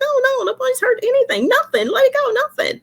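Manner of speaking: very high-pitched dismissal; mock exasperated denial. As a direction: shut the question down in a high squeal. Acted reply to anxious askers.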